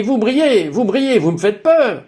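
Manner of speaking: high-pitched imitation of a scared woman; alarmed exclaiming. Fallen visitor tells the glowing speaker of fear, alarmed exclaiming. Frightened woman retold at the door.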